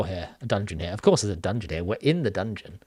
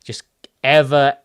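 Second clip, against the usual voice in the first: ordinary delivery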